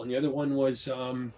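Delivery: ordinary delivery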